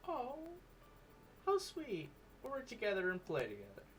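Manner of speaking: very high-pitched, swooning. Acted. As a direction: squeal it in a high falsetto. Swooning reply.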